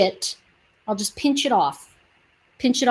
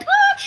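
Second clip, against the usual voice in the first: high-pitched delighted "ah!"; excited squeal. Acted. Nail seller gushing over a set she loves, high squeal. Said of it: "I'm like".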